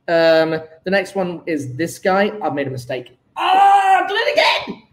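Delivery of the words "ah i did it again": high shouted annoyance; frustrated shout. Real, not acted. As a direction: yell at yourself for messing up. Angry at repeated mistake.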